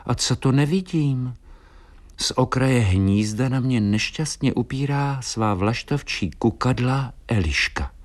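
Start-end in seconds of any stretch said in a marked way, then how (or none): none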